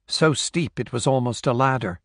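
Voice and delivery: plain reading voice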